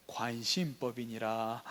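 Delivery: low, slow, drawn-out pronouncement; mock mind-reader. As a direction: intone it softly like an all-seeing ruler. (낮고 느린 독심술사 흉내)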